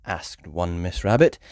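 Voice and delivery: usual speaking voice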